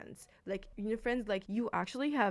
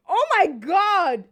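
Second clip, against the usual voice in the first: much higher, louder and slow; stretched-out delighted cry; 11 semitones higher, 14 dB louder, much slower than usual. Real, not acted. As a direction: draw it out in disbelief. Overwhelmed by the gift.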